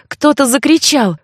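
usual speaking voice

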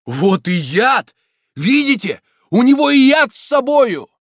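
high, loud accusing cry; triumphant mob shout. A narrator's reading. Crowd spotting the flask, triumphant shout. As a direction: point at the poison and yell. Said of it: "несколько голосов закричало разом".

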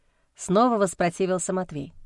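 ordinary delivery

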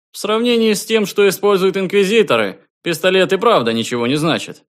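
high, loud and eager; bursting with delight; excited. A narrator's reading. Давид's voice in dialogue.

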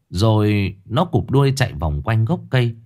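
plain reading voice

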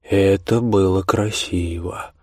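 drawling. Acted. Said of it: "растягивая слова".

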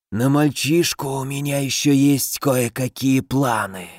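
rustle it like a dead thing speaking; inhuman rustling voice. Acted. The inhuman old man speaks.

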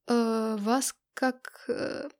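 very slow, halting question; hesitant. Acted. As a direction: drag out each unsure word. Hesitant student asks her name. (неуверенно, с запинками)

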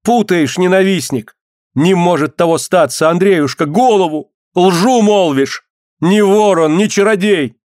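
high, emphatic protest; acted woman defending her husband; indignant outcry. A narrator's reading. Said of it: "крикнула Алёна".

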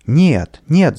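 two loud, rising cries of protest; shouted refusal. A narrator's reading. Children refusing to become nichevoki, shouted refusal. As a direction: cry out in firm refusal. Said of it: "закричали дети".

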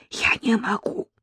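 hushed whisper into the phone. A narrator's reading. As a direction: whisper so nobody nearby hears. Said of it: "зашептала в трубку Лена".

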